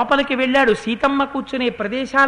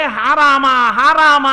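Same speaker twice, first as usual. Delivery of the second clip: louder mock wail, drawn out; imitated cry out; 3 semitones higher, 6 dB louder, much slower than usual. Rama imagines Sita calling for him, imitated louder cry. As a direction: call out as if for rescue, without screaming. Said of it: "అని అరిచుంటుంది".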